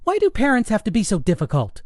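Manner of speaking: high, quick, exasperated daughter; frustrated teen. A narrator's reading. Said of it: "Ellie threw up her hands in frustration".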